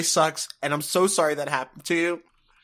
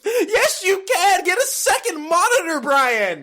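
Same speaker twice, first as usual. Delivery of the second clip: shouting; 6 dB louder, slower than usual. Real, not acted. Shouting at friend jokingly.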